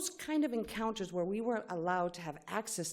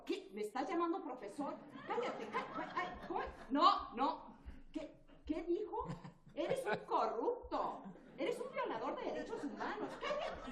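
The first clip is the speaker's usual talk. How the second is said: higher, softer, laughing through a hammed-up quarrel; mock angry official; 12 semitones higher, 9 dB quieter than usual. Reenacting a corrupt official's retort, higher and laughing. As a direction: play the official snapping back while half laughing. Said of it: "So, watch closely".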